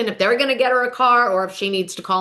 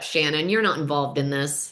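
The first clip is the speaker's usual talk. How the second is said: lower, softer brush-off; dismissive put-down; 8 semitones lower, 6 dB quieter than usual. Retold or imitated. Katie brushing off Shannon at the table, low dismissive. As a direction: wave her off, bored.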